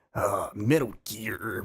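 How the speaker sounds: a hint of a gruff Snake growl; imitating David Hayter's Snake. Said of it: "real deep, gr-, throaty voice".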